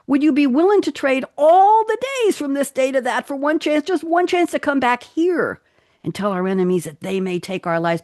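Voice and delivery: rally the troops like a war hero; dramatic movie speech. Acted. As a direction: epic speech building to a peak, then dropping for the last line. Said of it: "I can't do this in an accent. I'll just read it".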